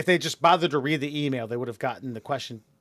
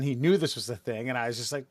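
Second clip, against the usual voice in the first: ordinary talk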